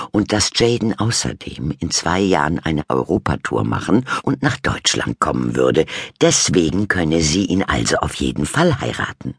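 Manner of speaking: usual speaking voice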